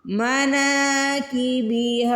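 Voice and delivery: मनाकिबिहा with the na and ha vowels drawn long; slow chanted मनाकिबिहा with long vowels; stretched na and ha. Shown as a demonstration. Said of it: "नून को भी खींच के अदा करना है और हा को भी".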